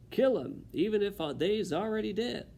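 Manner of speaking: high, loud kitchen-cook voice; Cook's gruff title. Acted.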